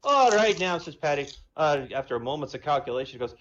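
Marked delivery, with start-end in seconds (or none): 0.0-0.8 higher, louder Irish brogue; Irish accent. Acted. Paddy the Irishman phoning the president, Irish accent. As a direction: play a cocky Irish pub man. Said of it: "Irish and Jamaican".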